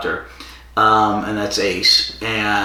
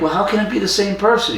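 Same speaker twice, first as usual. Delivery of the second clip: puzzled woman; 10 semitones higher, faster than usual. Retold or imitated. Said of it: "She's like".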